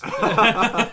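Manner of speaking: big burst of laughter; loud laugh. Real, not acted.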